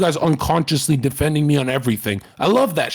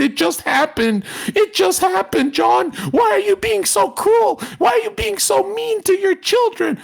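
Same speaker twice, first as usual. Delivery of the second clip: very high, hammed-up pleading cry; mock a wounded complainer, pitched way up; over-the-top high wailing plea to John; mocking plaintive voice.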